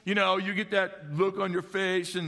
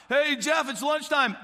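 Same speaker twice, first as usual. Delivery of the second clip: calling out; 6 semitones higher, 4 dB louder than usual. Retold or imitated. Parent calling kid to lunch.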